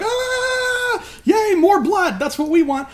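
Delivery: very high, mock frenzied whoop; bloodthirsty cheer. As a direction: cheer like a crazed soldier. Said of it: "they're like".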